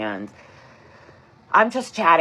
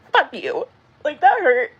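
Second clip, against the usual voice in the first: hurt outburst; 11 semitones higher, 6 dB louder than usual. Real, not acted.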